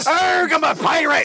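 slow hammed pirate call; lightly voiced cartoon pirate; mock pirate. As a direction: growl it like a pirate. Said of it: "not laughing and going".

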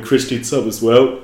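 words stretched through a yawn; talking mid-yawn. Real, not acted. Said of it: "sorry for yawning".

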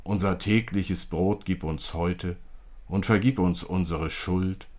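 usual speaking voice

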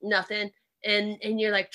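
usual speaking voice

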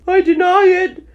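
high, loud, indignant protest; heated denial. Acted. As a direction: protest hotly at full pitch. March Hare argues with Hatter.

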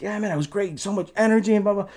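high-pitched gushing fan; excited fan praise. Retold or imitated. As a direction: imitate a thrilled fan gushing after the show. Crowd praising the band after show.

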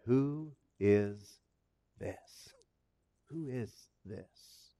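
very soft, low, halting; near-whispered amazement, with pauses; hushed awe. Acted. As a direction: breathe it out in stunned wonder. Disciples awed after the calm.

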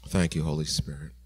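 soft, low worshipful thanks; quiet prayer. Real, not acted. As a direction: pray it softly and reverently.

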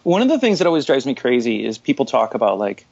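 ordinary talk, nothing marked

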